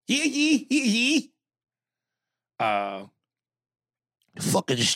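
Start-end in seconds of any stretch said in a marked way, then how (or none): 0.1-1.3 high mocking hee hee; mock giggle. Host mocking the email writer, high giggle. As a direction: giggle like a tease.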